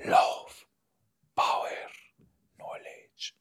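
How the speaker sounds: whispered temptation, one word at a time; mock demon whisper. Repeating the demon's promise to give, whispered. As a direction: fade into a faint whisper.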